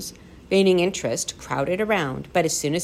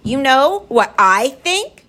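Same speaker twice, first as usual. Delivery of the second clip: high, loud, slow challenge; shouted; 7 semitones higher, 6 dB louder, slower than usual. A narrator's reading. Lindy unable to contain herself, shouting at gaboons. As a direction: shout it word by word. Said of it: "she shouted".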